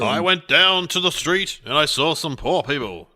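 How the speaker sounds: slow mock sermon cadence; preacher rhythm. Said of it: "that kind of thing".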